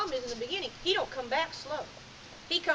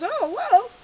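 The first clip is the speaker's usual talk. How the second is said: higher, louder startled "whoa"; startled dog; 9 semitones higher, 10 dB louder than usual. Acted. Dog turns around to its handler, startled. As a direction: voice a dog caught off guard.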